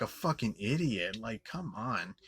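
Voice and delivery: ordinary talk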